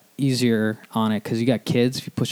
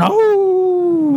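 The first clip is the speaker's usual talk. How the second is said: long high loud cartoon howl; hammed-up presidential howl; Nixon howl; 11 dB louder than usual. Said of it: "Don't remember him howling".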